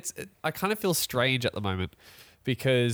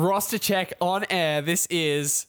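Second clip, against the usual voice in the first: mock announcer; 3 semitones higher, 6 dB louder than usual. Host announces a roster check on air, mock announcer. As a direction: announce it like a big live event.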